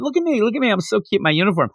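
put-on high, playful kid voice showing off; lightly voiced child bragging; cute little girl. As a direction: bounce it like a proud little girl. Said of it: "she was so cute running around".

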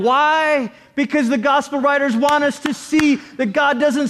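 rising pulpit intensity, pushing forward; fervent preaching. Acted. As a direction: preach it out to the whole room with fire. Preaching at full force.

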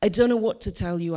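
normal talking voice

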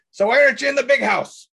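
high, loud questioning; character question. Acted. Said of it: "I ask you, it's like".

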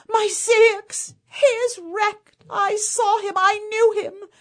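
high, loud anguished shout. Acted. Waking wife shouting that her husband is wrecked, high anguished shout. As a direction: cry out the terrible news. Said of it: "she shouted".

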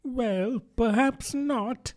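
soft, high, placating voice; soothing tone. A narrator's reading. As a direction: ease off slowly and gently to calm someone. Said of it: "said Alice in a soothing tone".

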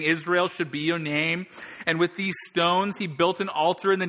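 high, loud storytelling sermon; preacher retelling the contest, loud and high; preaching at full force. Acted. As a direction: drive the scene home to the crowd.

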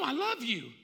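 loud, high acted cry of love; emphatic declaration. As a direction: plead it loudly as Peter. Peter insisting on his love.

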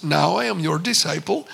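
put-on low-pitched Indian-accented declaration; thick Indian accent. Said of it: "in a very thick Indian accent".

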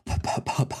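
ordinary talk

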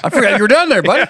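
laughing mock greeting. Talking to toes, laughing.

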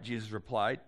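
ordinary delivery

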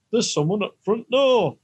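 high, loud Yorkshire announcement; Yorkshire accent. Acted. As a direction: call it out in mock Yorkshire. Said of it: "in a Yorkshire accent".

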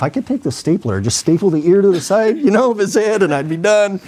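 half-joking angry outburst; mock vengeful rant. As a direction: pitch climbing in exasperation. Imagined revenge on patient, super pissed.

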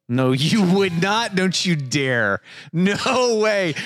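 high, drawn-out indignant protest; mock outrage. Real, not acted. Host refuses to believe co-host's pronunciation, playfully outraged. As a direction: stretch each refusal, pitched right up.

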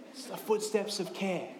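ordinary talk, nothing marked

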